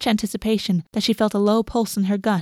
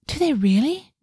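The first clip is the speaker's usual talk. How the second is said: "Do they really?" pitched up with a rising end; eager question; 6 semitones higher than usual. A narrator's reading. A girl thrilled by her new poison, eager.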